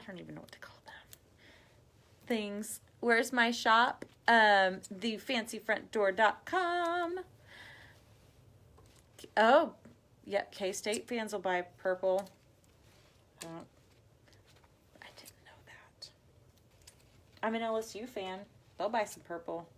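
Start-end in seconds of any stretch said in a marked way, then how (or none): 0.0-0.9 quick whispered aside to herself; half to herself, quick and whispered. Real, not acted. Crafter to herself while working, a quick whisper. 13.4-16.1 soft whisper to herself; whispered aside. Real, not acted. Reading a viewer's comment, whispered to herself.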